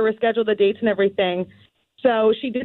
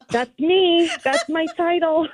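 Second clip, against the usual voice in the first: higher, tickled and proud; proud and thrilled; 7 semitones higher, much slower than usual. Retold or imitated. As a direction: claim the title with a laughing pride. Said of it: "I was like".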